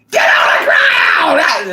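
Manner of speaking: drawn-out, high-pitched bellow; yelled command. Retold or imitated. Imitating a movie cop ordering people down, yelled command. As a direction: bark it out loud like a cop taking command. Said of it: "she yelling and taking command".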